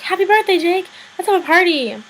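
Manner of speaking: high, loud birthday cheer; mock cheery parents. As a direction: imitate excited parents announcing a party.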